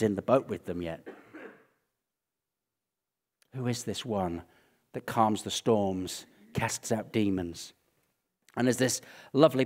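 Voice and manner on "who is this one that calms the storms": ordinary talk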